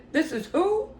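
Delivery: high, incredulous question; retold disbelief. Woman questioning a famous caller on the phone, incredulous.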